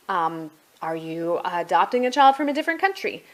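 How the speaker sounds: usual speaking voice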